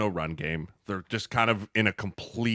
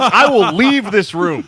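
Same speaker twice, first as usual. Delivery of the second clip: much higher, louder, slower threat; mock outraged shout; 12 semitones higher, 12 dB louder, slower than usual. Real, not acted. Escalating mock threat to leave.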